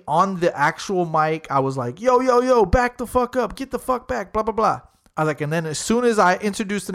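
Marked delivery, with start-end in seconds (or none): 2.0-4.8 high-pitched crowd-control call on the mic; mock hype-man warning, pitched up. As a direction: call out fast to move people back. Acting out his mic announcement.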